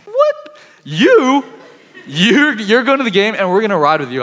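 jaw-drop shock, voice cracking way up; stunned disbelief. Retold or imitated. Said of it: "I'm like".

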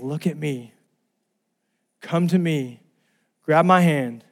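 calm, tender call in short phrases; gentle invitation. Retold or imitated. God calling to the listener, gentle calm invitation. God's loving call, same words softened.

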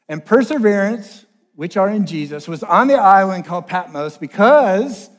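emphatic, projected Bible reading; Scripture reading. Acted. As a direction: proclaim the verse to the congregation. Reads Scripture aloud to congregation.

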